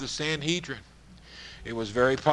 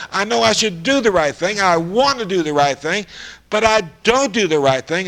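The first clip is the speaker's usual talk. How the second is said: louder and higher, pleading with himself in torment; anguished confession as Paul; 5 semitones higher, 5 dB louder than usual. Acted. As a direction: cry out the apostle's inner struggle. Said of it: "He said".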